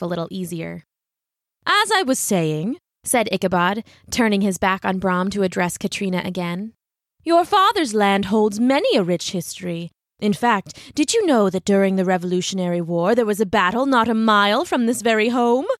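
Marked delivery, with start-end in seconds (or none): 1.6-2.8 slow, higher, self-important drawl; pompous resumption. Acted. Ichabod turns his back on Brahm, pompous and drawn out. 7.2-15.7 swooping schoolteacher showing off; pompous lecturer. Acted. Ichabod lectures Katrina on local history, pompous and swooping.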